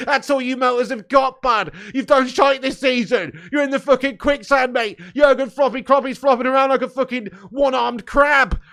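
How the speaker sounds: loud, high shouted taunts; relentless jeering football-fan tirade; gloating rant. Real, not acted. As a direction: tear into the rival fans at full volume. Gloating rant at rival fans.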